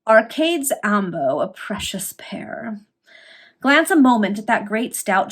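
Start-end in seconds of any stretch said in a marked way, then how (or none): 1.4-2.8 a hushed, breathy aside to the listener; sly whispered footnote. A narrator's reading. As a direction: drop to a whisper for the joke. Wry aside after Latin tag.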